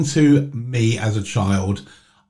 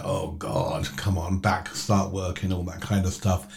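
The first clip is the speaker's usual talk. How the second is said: low, much softer half-asleep mumble; soft reluctant morning groan; groggy morning grumble; 4 semitones lower, 10 dB quieter than usual. Retold or imitated. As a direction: mumble it like you just woke up. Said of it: "It's like".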